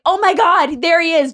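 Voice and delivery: alarmed excitement. Retold or imitated. Woman spotting her boyfriend in the snow, excited alarm. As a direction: play the sudden spotting of him.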